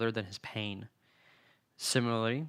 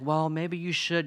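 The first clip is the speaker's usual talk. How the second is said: mock friend retort; 7 semitones higher, 7 dB louder, slower than usual.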